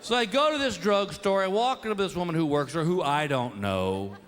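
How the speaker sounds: usual speaking voice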